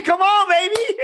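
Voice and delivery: high-pitched playful call-out; teasing cheer. Real, not acted. Teasing co-host, cheering her on.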